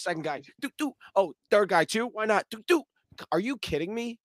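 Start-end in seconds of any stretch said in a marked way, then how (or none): 0.6-0.9 juke sound effect. Retold or imitated. Imitating the juke move sound. 2.5-2.8 juke sound effect. Retold or imitated. Imitating the juke move sound.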